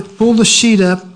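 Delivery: usual speaking voice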